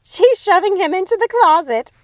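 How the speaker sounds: delighted, voice jumped way up; excited. Acted. Sister gleefully reporting the mirror scene, high and loud.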